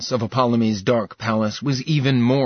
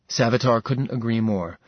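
plain reading voice

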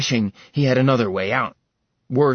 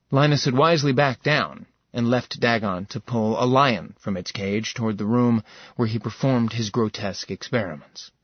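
ordinary delivery, nothing marked

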